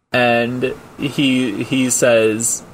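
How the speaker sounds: ordinary delivery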